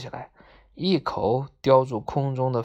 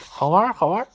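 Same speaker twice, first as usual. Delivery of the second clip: higher, eager squeal of approval; excited cry; 5 semitones higher, 4 dB louder, much faster than usual. A narrator's reading. Friend joining in about the new toy, excited cry. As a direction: chime in with delight. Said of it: "红皮尖儿也叫".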